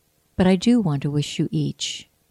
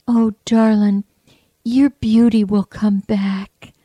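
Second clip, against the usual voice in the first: slow, louder Jamaican reassurance, slightly raised; Jamaican accent; 4 semitones higher, 6 dB louder than usual. Retold or imitated. Woman comforting a customer after a bad fall, lightly imitated Jamaican accent. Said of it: "beautiful Jamaican dialect".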